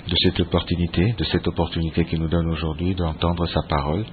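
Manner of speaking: ordinary delivery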